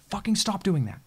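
frustrated yell. Real, not acted. Yelling at the craft.

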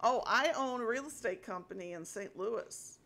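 usual speaking voice

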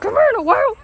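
high, loud summons; calling out. Real, not acted. Vlogger calling Noelle to come here, calling out.

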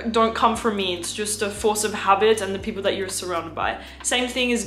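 ordinary talk, nothing marked

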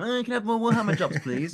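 high, fast pleading put-on voice; hammed-up cheeky plea; mock whiny begging. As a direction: whine like a needy actor asking for work. Mock begging for more jobs.

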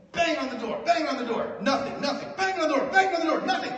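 building pitch and volume through the repeats; mounting knocking story. Retold or imitated. Comic telling the bit about knocking, building excitement. Retelling banging on a door.

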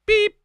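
a sharp, very high mock bleep over the swear; high censor bleep. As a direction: imitate a TV censor beep, squeaky and quick. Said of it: "Where's a sensor when you need one?".

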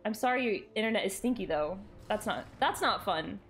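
ordinary talk

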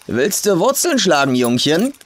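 put-on old farm woman calling to the idle hitchhiker. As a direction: call him over across the field. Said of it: "rief sie nach ihm".

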